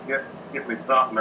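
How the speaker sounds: ordinary talk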